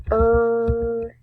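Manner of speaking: "er-e" held long and high; sound it out. Shown as a demonstration. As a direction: stretch out "er-e" high and loud. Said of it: "we've got the sounds".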